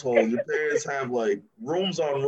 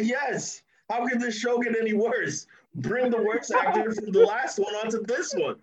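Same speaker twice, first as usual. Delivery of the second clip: high-pitched, hammed-up eager plea; excited; 7 semitones higher than usual. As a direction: beg for it with gleeful excitement. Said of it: "I'm excited".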